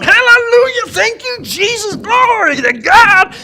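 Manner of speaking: high, loud, ringing hallelujah shout; ecstatic church shouting. Retold or imitated. Old farmer shouting praise in church, ecstatic high shout. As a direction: cry out praise like a man carried away. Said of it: "Start shouting".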